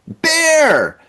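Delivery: sudden high yell of alarm; shouted warning. Retold or imitated. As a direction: yell it like she just saw a bear. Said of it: "after hearing her shout".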